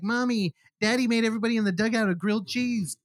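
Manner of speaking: lightly voiced kid, pitched high; child impression. Kid tells Mommy about Daddy's cooking, lightly imitated child. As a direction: imitate a little kid telling on Dad.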